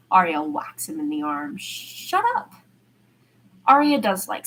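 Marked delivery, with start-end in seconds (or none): 1.6-2.5 much higher put-on voice; fake-annoyed snap back; girlish mock protest. Arya whacking his arm, high mock protest.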